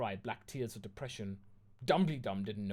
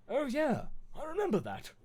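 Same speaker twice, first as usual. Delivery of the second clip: high-pitched affected character voice; try-hard goth, affected and high; trying to be gothic; 14 semitones higher, 3 dB louder than usual. Acted. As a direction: put it on, pitched way up. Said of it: "trying to be all gothic".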